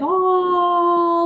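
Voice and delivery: "all" held long on a high note; drawn-out emphasis. Shown as a demonstration. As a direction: stretch "all" out to show the long distance. Stretched to show a long drive.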